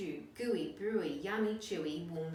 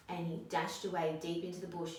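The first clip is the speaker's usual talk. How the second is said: ordinary delivery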